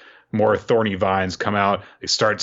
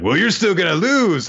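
mock taunt; 10 semitones higher, 4 dB louder than usual. Doubt taunting opponent.

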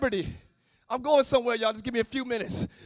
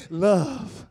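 breathed love; 10 semitones lower, 4 dB quieter than usual. Shown as a demonstration. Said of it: "Somebody just say".

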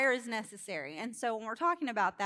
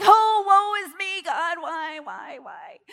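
loud, high, drawn-out melodramatic lament; mock wailing; 6 semitones higher, 12 dB louder, slower than usual. Stuck in traffic crying to God, mock wail. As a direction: cry out "why" in hammed-up despair, fading.

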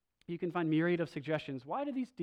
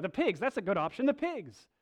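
louder, higher, eager hammed-up suggestion; eager demons; 5 semitones higher, 4 dB louder than usual. Demons eagerly suggesting the pigs to Jesus, mock enthusiasm. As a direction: pitch the pigs like a bright idea. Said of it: "And so, like".

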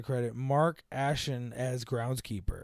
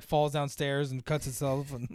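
normal talking voice

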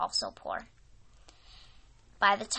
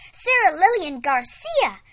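grinning; 8 semitones higher, 9 dB louder than usual. Acted. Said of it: "grinning ear to ear".

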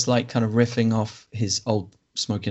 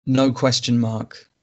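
normal talking voice

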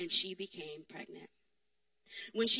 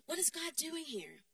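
ordinary talk

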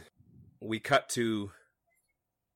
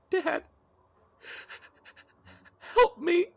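put-on child pleading. Fading Mercy begs her dad for help, high and pleading. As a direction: beg like a frightened little girl. Dying child calls father.

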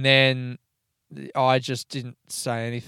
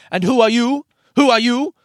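high, loud, confrontational questioning; imitated angry person; 10 semitones higher, 8 dB louder than usual. Angry housemate challenging a stranger on the couch, imitated aggression. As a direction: imitate an aggressive demand, pitched up and rising. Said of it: "this angry person looks over at me".

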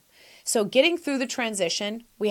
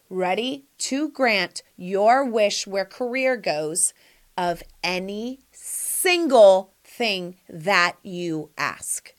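ordinary delivery

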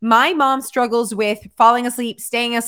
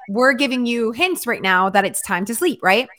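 ordinary delivery

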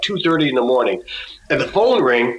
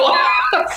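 high burst of laughter. Real, not acted. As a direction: laugh out at the absurdity. Laughing at absurd story.

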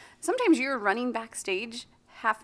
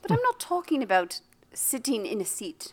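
hushed, put-on posh British; affected upper-class British; posh British accent. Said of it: "You sound so fucking posh".

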